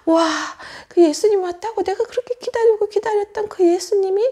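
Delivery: storybook joy, lightly acted; overjoyed. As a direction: marvel happily at long-awaited news. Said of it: "너무너무너무 기뻤어요".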